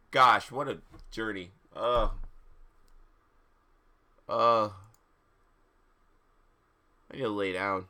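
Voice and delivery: ordinary delivery, nothing marked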